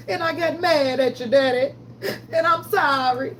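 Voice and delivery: high, slow, tearful apology to Daddy. Retold or imitated. Quoting a gospel line to viewers, high tearful apology. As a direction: cry out your sorry to God. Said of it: "Remember [name] said".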